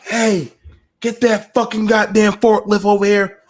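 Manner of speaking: a mild retold battle yell; retold scream. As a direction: shout across the fight, half-hearted. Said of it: "I was screaming".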